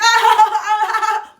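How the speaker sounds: long, high mock guffaw starting loud; dad's exaggerated laugh. Showing how her dad can be, exaggerated laugh. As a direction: laugh big and high, as an imitation. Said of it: "my dad can be like".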